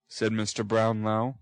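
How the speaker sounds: usual speaking voice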